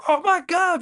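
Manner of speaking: very high, loud squeal; hammed-up astonished outcry; mock amazement. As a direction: squeal it in exaggerated shock. Voices Yoda amazed by the voice.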